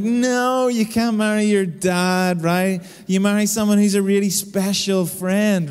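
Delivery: high, loud, drawn-out mock refusal; hammed-up exasperated dad putting his foot down; retold dad's protest. Dad to daughter who wants to marry him, mock protest.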